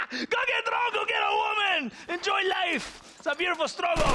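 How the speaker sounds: rowdy tipsy encouragement; drunken exuberance. Acted. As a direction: egg them on to party. Drunk captain urging revelry.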